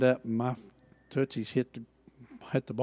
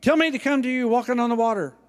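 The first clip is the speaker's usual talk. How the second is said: loud, high call to Jesus across the water; a fast, eager call over the waves; 10 semitones higher, 7 dB louder than usual. A narrator's reading. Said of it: "Peter called to him".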